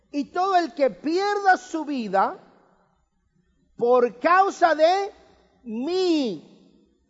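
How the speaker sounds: pierda and causa stretched slowly and lifted; slow emphatic quote. Shown as a demonstration. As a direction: spell out the formula slowly. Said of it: "Y ahí viene su fórmula".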